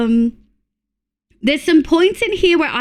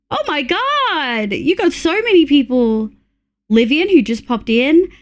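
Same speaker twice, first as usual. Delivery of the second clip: ordinary talk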